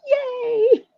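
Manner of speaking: high squealed retold cheer; excited cheer. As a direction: let out a high, drawn-out cheer. Retelling own cheer at news.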